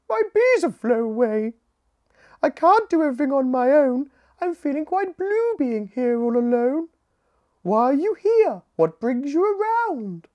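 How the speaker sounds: high, loud queen bee voice; a lonely queen pouring out her troubles; frazzled queen bee. Acted. Queen bee complaining to Scout that her bees left, high and fretful.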